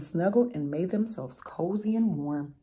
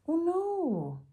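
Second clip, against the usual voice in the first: dismayed cry; 12 semitones higher, 3 dB quieter than usual. Acted. Mitya loses mitten, dismayed.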